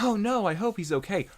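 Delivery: high-pitched hammed-up worry; mock concern. Onlookers watching Marco at risk, high mock concern. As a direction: fret in a high voice. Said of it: "everyone else is kinda going like".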